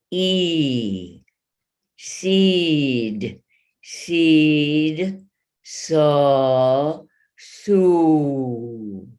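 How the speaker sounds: "seed", "saw", "sue" each vowel shaped fully and distinctly; vowel contrast. Shown as a demonstration. Voice teacher contrasting vowels. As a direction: move the tongue through front to back. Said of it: "Hear the difference".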